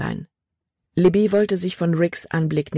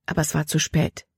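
usual speaking voice

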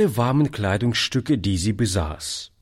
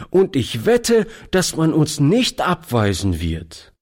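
lively; 8 semitones higher than usual. A narrator's reading. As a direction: plead brightly and fast. Toni pleads, voiced high.

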